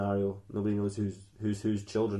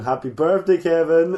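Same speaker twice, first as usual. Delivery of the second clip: raised, louder mock-cheerful chorus; cheery birthday greeting; 4 semitones higher, 7 dB louder than usual. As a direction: greet the birthday boy with a big smile. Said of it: "they're all smiling".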